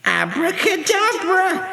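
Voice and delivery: high, slow, drawn-out incantation; shouted magic spell. A narrator's reading. Winnie waves her wand five times, high shouted spell. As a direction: stretch the spell out high and ringing. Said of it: "waved it five times and shouted".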